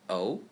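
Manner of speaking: O rising upward, second tone. Shown as a demonstration. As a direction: lift O upward.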